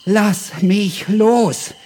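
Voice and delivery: high, loud cry for help, slowing at the end; high, loud panicked plea, dragging out the last words. Acted. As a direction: scream to be let go, dizzy and scared. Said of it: "brüllte die Leopardin".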